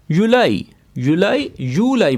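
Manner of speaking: युलाई with a y sound, not j; Arabic pronunciation. Shown as a demonstration. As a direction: say युलाई clearly for the class. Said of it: "जीम का इस्तेमाल नहीं हुआ". (युलाई, जीम के बिना)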